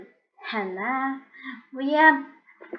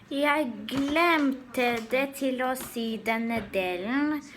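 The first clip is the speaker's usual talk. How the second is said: ordinary delivery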